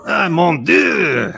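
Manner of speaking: high, loud, slow French wail; French accent. Pretend. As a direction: shout it like an outraged Frenchman. Mocking France in a meme.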